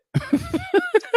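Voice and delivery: high burst of laughter; short giggling laugh. Real, not acted. Laughs at himself.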